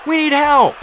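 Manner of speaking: high, loud cry for help; shouted plea in battle. A narrator's reading. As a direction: yell desperately for backup. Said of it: "I shouted".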